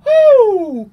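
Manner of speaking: long, loud, very high "Oh"; shocked oh. Retold or imitated. Fan retelling his shock at the beheading, very loud and high. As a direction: cry out in shock at the screen. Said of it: "I was like".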